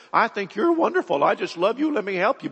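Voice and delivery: high-pitched, gushing sweet talk; butter them up with insincere praise, pitched high; mock flattery. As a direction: play a hammed-up flatterer winning someone over. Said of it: "you would convince her".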